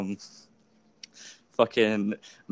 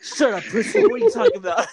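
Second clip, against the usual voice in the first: high laughing mock taunt, much higher; mock cocky teen; 5 dB louder than usual. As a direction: squeal the insult through laughter. Quoting Andrew mouthing off.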